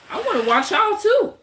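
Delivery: high, loud mock challenge; playful high taunt. Real, not acted. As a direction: throw it back at them, way up high. Taunting viewers back.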